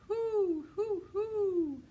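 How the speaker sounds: high, loud owl calls; loud owl hoot. A narrator's reading. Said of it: "They were shouting".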